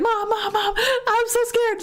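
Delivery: high, drawn-out wail for mom; mock tearful crying. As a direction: imitate a scared, crying kid. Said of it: "and I was crying".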